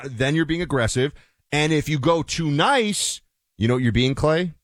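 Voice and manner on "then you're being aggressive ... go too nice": ordinary delivery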